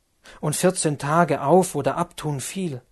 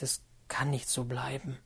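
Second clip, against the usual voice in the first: breathless whisper, voice nearly gone; whispered plea; 9 dB quieter than usual. Acted. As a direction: whisper it as a last begging plea. Lotte smeekt Werther om rust.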